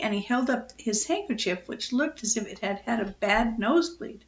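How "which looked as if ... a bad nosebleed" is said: ordinary delivery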